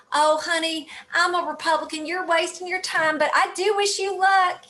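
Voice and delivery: lightly voiced kind Texas lady; sweet Texas accent. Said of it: "in a sweet little Texas accent".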